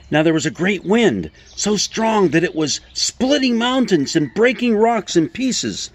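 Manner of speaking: emphatic reading that climbs in pitch; dramatic scripture reading. Acted. Preacher reading Elijah's mountain story aloud, dramatic and emphatic. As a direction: build each disaster up, pitched high with strong pauses. I want to read this portion.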